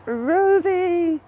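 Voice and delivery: high, loud call of a name. Real, not acted. Streamer hailing Rosie on a walk, calling out.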